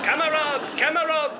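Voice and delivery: put-on high pleading camarade, camarade in a foreign accent; hammed-up surrendering stormtroopers; mock German surrender. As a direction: squeal camarade like surrendering soldiers.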